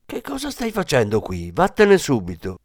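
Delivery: understated yell of an angry grandmother; narrated outburst, pitched well up; grandmother's yell. A narrator's reading. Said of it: "la nonna che urlò".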